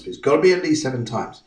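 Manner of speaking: ordinary talk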